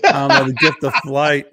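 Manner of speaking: talking through laughter; laughing retelling. Real, not acted. Telling Walken's tail story, laughing through it. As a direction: crack up while delivering the reply.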